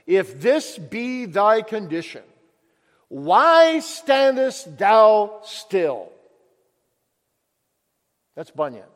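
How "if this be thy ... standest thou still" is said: slow, loud, drawn-out demand; urgent challenge. Acted. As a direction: call him to move, slowly and hard. Evangelist's challenge in story.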